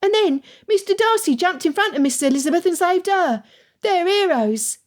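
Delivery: put-on high child shouting. A narrator's reading. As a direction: shout like an indignant boy. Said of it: "but he shouted louder".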